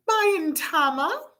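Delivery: put-on high-pitched young Salma; little girl's reply. A narrator's reading. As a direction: answer in a high girlish voice. Little girl's answer in story.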